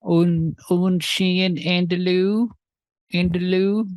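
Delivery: lifted, slowed French with a questioning rise on "andalou"; mock fancy French accent. Friend teased for his pronunciation, answers in hammed-up French. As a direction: savour "un chien andalou" like ordering off a fancy menu. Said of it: "It sounded like Italian".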